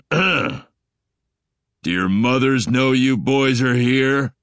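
deep, demanding alien dominant male voice; loud, slow, booming bass demand; extremely deep bass voice. Acted. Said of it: "I demanded in the deepest voice I could manage".

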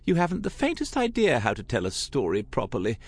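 high, brisk chiding aunt; lightly indignant, rattled off; protesting. A narrator's reading. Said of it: "Tessa protested".

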